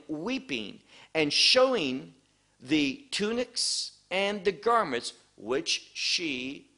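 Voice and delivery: slow, solemn Bible reading; scripture reading. Acted. Teacher reading Acts aloud to the class, solemn and slow. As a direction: read the verse out as holy text.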